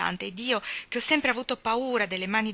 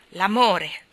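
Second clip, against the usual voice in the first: loud scornful echo with a scoffing laugh; 6 dB louder than usual. Acted. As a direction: throw the word back with contempt. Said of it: "ripetei con sprezzo".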